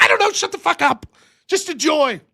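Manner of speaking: loud, high dismissive outburst; exasperated snap. Retold or imitated. Husband snapping at wife during wrestling, exasperated. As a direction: wave off the questions irritably. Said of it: "I'm like".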